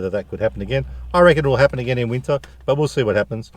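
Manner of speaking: ordinary talk, nothing marked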